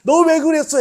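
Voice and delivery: loud, high accusing demand; public scolding. Acted. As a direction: tell someone off so the neighbours hear. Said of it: "그 사람을 망신을 줘가면서".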